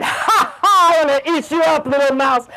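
big triumphant "ha, ha" then a playful threat; gloating lion. Acted. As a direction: crow over the caught prey. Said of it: "And he said".

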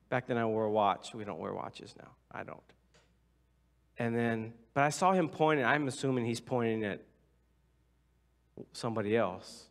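ordinary talk, nothing marked

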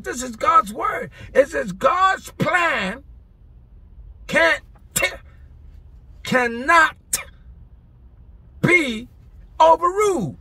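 loud, high, fired-up preaching; preaching at full force. Acted. Preacher firing up the congregation, loud and high. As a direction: preach it out with rising fire.

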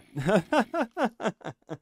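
high, loud burst of laughter; helpless laugh. Real, not acted. Gamer who just died again, laughing high and helplessly. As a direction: laugh helplessly at your own bad luck. Laughs at dying a second time.

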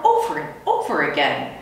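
usual speaking voice

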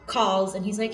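ordinary talk

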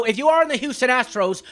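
ordinary talk, nothing marked

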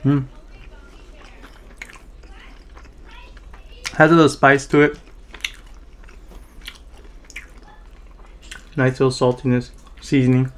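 talking while chewing food; muffled by food in mouth; mouth full, chewing. Real, not acted. Seen 0: Bites wing at -5, cheeks show chewing while talking, wing in hand.

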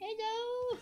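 high soft cooing hello; baby talk to dog. Acted. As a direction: coo at the dog. Greeting the dog again.

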